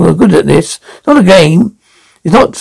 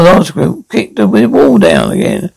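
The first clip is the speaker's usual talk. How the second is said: ordinary talk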